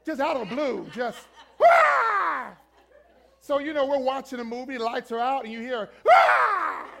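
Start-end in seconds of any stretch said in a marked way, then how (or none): 1.6-2.5 piercing high loud scream out of nowhere; sudden shriek. Retold or imitated. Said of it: "but she always screamed". 5.4-6.9 slow, high, loud scream in the dark; drawn-out scream. Retold or imitated. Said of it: "but she always screamed".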